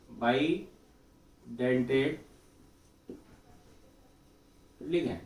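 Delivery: Bidentate stretched very slowly, long pause, then ligand; drawn-out bidentate, delayed ligand; slow dictation. Real, not acted. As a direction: spell out bidentate slowly as if writing it. Writing out the term while saying it.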